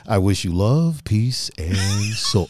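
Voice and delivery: lightly voiced deep, slow TV host; a hint of a smooth Soul Train host; Don Cornelius sign-off. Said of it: "I wait for Jay to be like".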